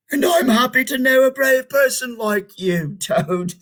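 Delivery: a hint of a cheerful Frog. A narrator's reading. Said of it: "said Frog".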